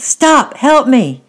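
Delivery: loud, high desperate call; shouting for help. A narrator's reading. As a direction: yell to stop someone far off. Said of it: "shouted at the top of her voice".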